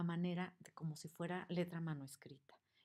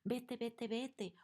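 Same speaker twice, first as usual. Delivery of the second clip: high, rising to loud, chasing someone out; shouting; 7 semitones higher, 4 dB louder, slower than usual. Acted. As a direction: yell them away. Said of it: "vemos los gritos donde le dicen".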